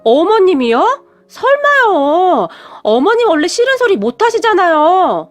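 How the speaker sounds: surprised. Acted. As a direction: gasp it out in disbelief, drawing out 설마요. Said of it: "제가 놀라서 물었는데요". (깜짝 놀라 믿기지 않는 듯 높고 느리게)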